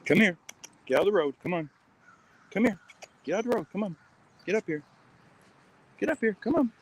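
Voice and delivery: high, urgent calls to a dog; calling the dog. Real, not acted.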